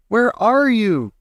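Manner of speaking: high, slow desperate call; calling out to a vanished child; frantic. A narrator's reading. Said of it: "screamed Missus T V".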